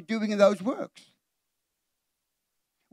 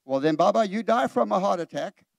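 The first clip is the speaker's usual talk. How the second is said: normal talking voice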